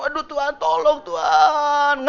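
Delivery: high, slow, pleading groan to God; groaning prayer. Acted. Woman in labor groaning to God, pleading high and slow. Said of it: "Ngerang gitu, groaning".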